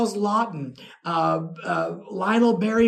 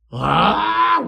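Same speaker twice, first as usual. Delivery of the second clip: loud, high mock shriek; retold shocked scream; 10 semitones higher, 8 dB louder than usual. Fan learns she shot Lugosi, loud high mock scream. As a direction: burst out in an exaggerated yelp.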